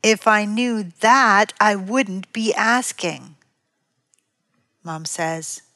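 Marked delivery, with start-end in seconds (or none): 0.0-3.3 slow, drawn-out sneer. Acted. Child answering mom's question about the backpack, sneering. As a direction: sneer it like a put-upon child. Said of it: "responds in a sneering voice".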